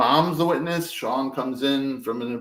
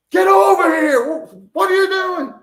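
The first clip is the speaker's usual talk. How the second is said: screaming; 10 dB louder than usual. Retold or imitated. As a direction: scream across the yard in fear. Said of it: "my mom and I screamed".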